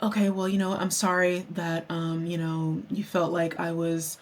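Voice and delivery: soft, low, backing-down apology; subdued, hesitant sorry; retold apology. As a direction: apologise meekly and quietly, imitating someone. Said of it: "she apologized".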